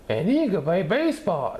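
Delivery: put-on high, loud boy's shout. A narrator's reading. Scotty shouting to reporters, high and loud. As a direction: call it out proudly. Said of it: "shouted Scotty".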